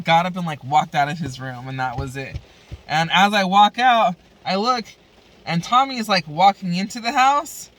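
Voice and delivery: ordinary talk, nothing marked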